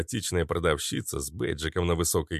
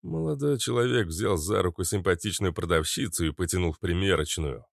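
ordinary delivery, nothing marked

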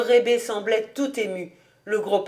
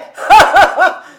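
high, loud burst of laughter; mocking laugh; 11 semitones higher, 14 dB louder than usual. A narrator's reading. Kobus laughs off the old man's prediction, hearty scoffing laugh. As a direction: laugh loudly at the absurd idea.

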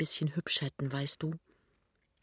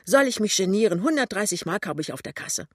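higher, brisk and carefree; merry outburst; 4 semitones higher, 6 dB louder, faster than usual. A narrator's reading. She reveals her savings to him, brisk and merry. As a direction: blurt it out, throwing off the embarrassment. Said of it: "lacht sie plötzlich".

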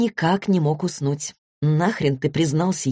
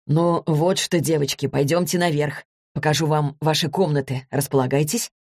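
usual speaking voice

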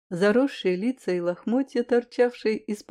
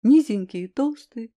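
ordinary delivery, nothing marked

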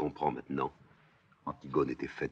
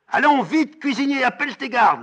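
very high, loud goading; taunting shout; 12 dB louder than usual. Acted. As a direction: goad him at full voice. Antigone provoque Créon. (provocation hurlée, très aiguë)

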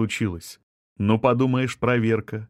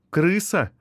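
high-pitched query on the word; questioning echo; 7 semitones higher than usual. A narrator's reading. Wife echoing her husband's insult, questioning. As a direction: throw the word back as a question. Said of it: "переспросила Ирина".

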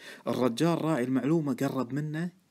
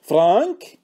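loud, raised call to someone next door; 7 semitones higher, 12 dB louder, much slower than usual. A narrator's reading. Deacon summoning his broker from the next room, calling out. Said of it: "وتالي نادى".

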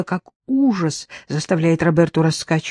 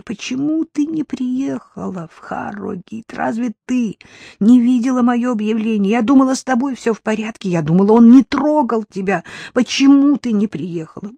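higher, louder, anguished questions; anguished pleading; 5 semitones higher, 5 dB louder than usual. Acted. Jillian pleading with her sister, anguished. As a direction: plead desperately.

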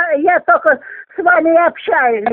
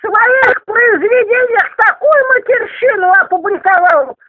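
foul-mouthed granny; 7 semitones higher than usual. Real, not acted. Upset old woman defending swearing. (ругань сквозь слёзы со срывом на крик)

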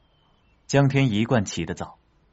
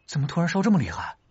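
worried; 9 semitones higher, much faster than usual. Acted. Alarmed at friend's high fever.

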